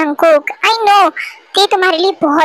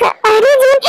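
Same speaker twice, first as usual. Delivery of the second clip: usual speaking voice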